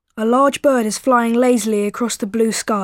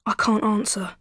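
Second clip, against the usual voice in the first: softer, subdued and sad; 6 dB quieter than usual. A narrator's reading. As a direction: say it quietly, defeated. Sad dreamer admits failure to teacher.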